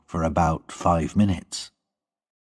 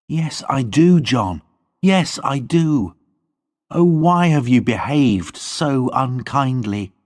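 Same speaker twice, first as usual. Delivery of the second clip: put-on higher, pleading young woman; Lorna's voice; 6 semitones higher than usual. A narrator's reading. Lorna pleading with John, higher young woman's voice. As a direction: plead with him as Lorna, voice lifted.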